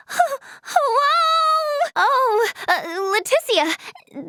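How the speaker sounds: high wavering cry of alarm; frightened. Acted. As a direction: cry out, then falter into her name. Frightened aboard ship floating in space.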